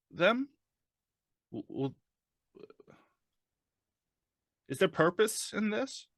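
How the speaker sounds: halting, stammering patient, voice climbing high; strapped-down man haltingly searching for reassurance; Frank, nervous captive. Acted. Player voices Frank strapped to gurney.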